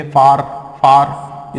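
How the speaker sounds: फार said slowly, long open aa, both times; slow pronunciation demo. Shown as a demonstration. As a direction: stretch out फार with a long aa vowel. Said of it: "तो कैसे बोलेंगे?".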